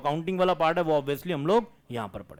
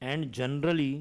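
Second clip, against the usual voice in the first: slow dictation; 2 semitones lower, 5 dB quieter, much slower than usual. Acted. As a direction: dictate word by word, with long pauses. Dictating notes to the class.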